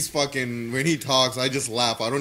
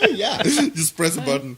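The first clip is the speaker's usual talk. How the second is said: laughing through the words, higher; real giggling reply; 5 semitones higher, 6 dB louder than usual. Real, not acted. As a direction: chuckle while you speak. Laughing at the joke.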